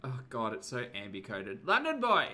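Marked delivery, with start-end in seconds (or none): 1.7-2.3 loud, high call across the room. Real, not acted. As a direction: call out to him, hopeful. Calling out for the boyfriend.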